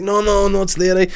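high, flustered mock insistence; embarrassed aunt insisting at the vet, high and mock. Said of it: "she's like".

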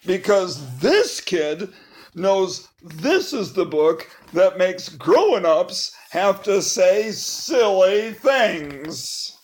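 plain reading voice

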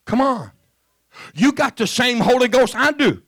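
loud, high, fiery preacher shout; preaching at full force. Acted. Pastor urging the church to open their mouths, shouting. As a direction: preach it out to the church, all fire.

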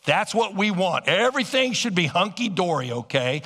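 ordinary delivery

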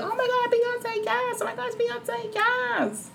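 mock-gush like a starstruck fan. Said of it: "be like".

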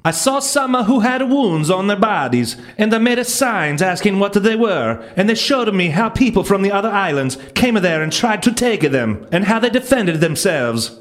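hammed-up Italian accent reading Columbus's journal; horrible Italian accent. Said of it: "Yes, that's a horrible Italian accent".

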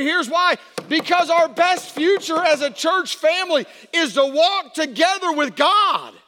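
high, very loud pulpit shout; booming, impassioned preacher's proclamation; full-force preaching. Acted. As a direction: shout it out to the whole church, drawing words out.

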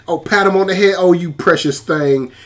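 ordinary delivery

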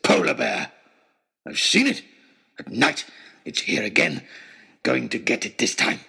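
a half-mad trapper insisting on the bear; wild trapper. Acted. Wild trapper ranting about bear.

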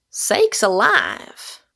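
slow, drawn-out amazement; mildly awestruck, stretched words; almost awed. A narrator's reading. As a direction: exclaim in slow wonder. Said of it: "almost in an awed tone".

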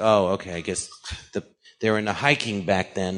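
quiet, low deadpan sarcasm; dry sarcasm. Retold or imitated. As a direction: feign acceptance, low and offhand. Mocks a Holocaust denier's claim.

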